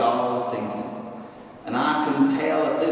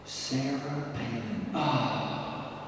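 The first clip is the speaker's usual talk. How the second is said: soft, slow, savoured name, then a sigh; quietly relishing it; hushed reverence; 7 dB quieter, much slower than usual. Acted. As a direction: breathe the name like holy words. Savouring the two hallowed words.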